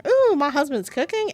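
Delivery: high, loud excited squeal of delight; gleeful hype outburst; playful excitement. Real, not acted. Sudden playful aside mid sad scene.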